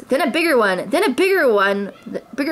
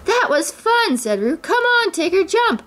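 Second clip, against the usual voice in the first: put-on high childlike voice, eager; a small excited child urging his friend; little Roo; 11 semitones higher than usual. A narrator's reading. Roo calling up to Tigger in a high child voice.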